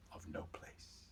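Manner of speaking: fading to a whisper; very soft, low and breathed away; hushed whisper. A narrator's reading. Storyteller describing the middle of nowhere, whispered.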